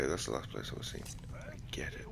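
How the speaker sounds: soft unvoiced whisper. Real, not acted. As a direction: breathe it under your breath. Quiet stealth section.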